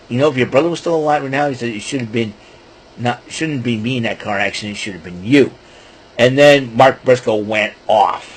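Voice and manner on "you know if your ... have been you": low, hushed retold taunt. Vlogger retelling MJF's cruel taunt, low and hushed. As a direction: drop the voice, mild menace. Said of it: "until he said".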